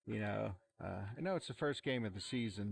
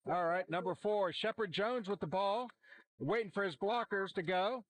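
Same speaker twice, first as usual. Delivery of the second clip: excited play call; 6 semitones higher than usual. Real, not acted. Live kickoff return call.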